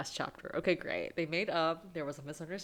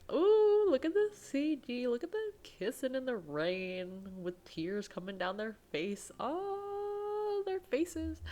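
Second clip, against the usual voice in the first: high, gushing squeal over the scene; delighted fangirl squealing at the screen; excited squeal; 9 semitones higher, slower than usual. Real, not acted. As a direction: gush over the kiss like a delighted fan. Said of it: "I'm emotional".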